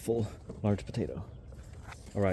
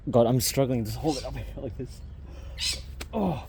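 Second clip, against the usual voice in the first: straining while lifting; effort grunt; 2 semitones higher than usual. Real, not acted. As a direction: grunt through the heavy lift. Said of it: "sorry for the grunting".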